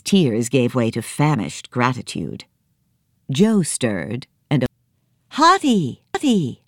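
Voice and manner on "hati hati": calling her name, high and glad; boy waking to see his elephant; joyful cry. Acted. Joe crying out joyfully to the elephant. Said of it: "he cried".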